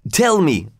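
begging. A narrator's reading. Leroy begging Jarvis for the story, eager plea. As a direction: beg for it, pitch lifted. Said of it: "begged Leroy".

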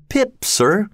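put-on high, timid child's voice; frightened boy. Pip answering the convict, high and timid. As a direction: answer small and scared.

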